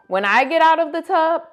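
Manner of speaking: plain reading voice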